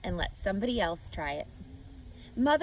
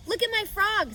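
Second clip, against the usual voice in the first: put-on much higher boy's voice; a proud little boy; Avery, high and eager; boy Avery showing off; 11 semitones higher than usual. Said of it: "said Avery".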